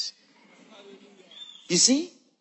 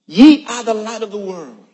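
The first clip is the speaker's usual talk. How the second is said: loud forceful declaration; 8 dB louder than usual. Real, not acted. Preacher declaring the verse forcefully to the church. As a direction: hit it with power. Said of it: "He said it with force".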